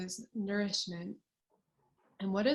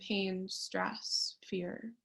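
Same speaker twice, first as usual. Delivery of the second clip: ordinary talk, nothing marked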